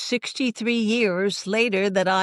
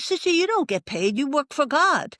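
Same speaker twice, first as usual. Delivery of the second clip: much higher, playful teasing; voicing the student; 8 semitones higher than usual. Student teases the sister about her pay, high and playful. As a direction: tease her lightly, pitched way up.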